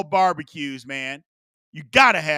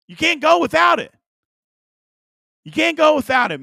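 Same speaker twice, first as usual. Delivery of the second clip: much higher and louder, insisting; excited insistence; 10 semitones higher, 6 dB louder than usual. Real, not acted. Host to chat, high excited insistence.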